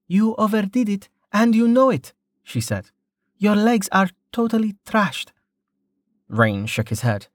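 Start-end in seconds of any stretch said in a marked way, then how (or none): 0.1-5.3 put-on young woman, a touch higher, gently scolding; lightly voiced concerned healer; Amelia's voice. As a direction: chide him like a worried friend.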